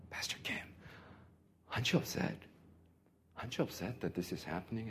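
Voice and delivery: whispered, barely voiced question; hushed whisper. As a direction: lean over and whisper it so nobody hears. Whispering to pastor in airport.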